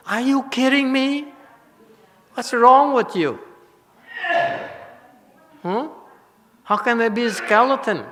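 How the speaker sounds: high-pitched, exaggerated disbelief; mock incredulity. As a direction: challenge them in a high, incredulous voice. Mock disbelief at the monk's claim.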